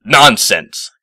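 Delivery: loud indignant cry; cried out. Acted. Professor loudly dismissing Twilight's doubt, indignant cry. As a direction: scoff it out loudly. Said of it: "cried the professor".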